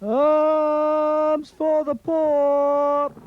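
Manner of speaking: high, slow drawn-out street cry; beggar calling out. Acted. Beggar in the street crying out to passers-by, high and slow. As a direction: hawk it to the passing crowd, long and high.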